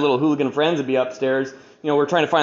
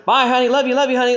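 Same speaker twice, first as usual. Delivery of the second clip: cheery dad voice; 6 semitones higher, 3 dB louder than usual. Retold or imitated. Dad leaving cheerfully for court.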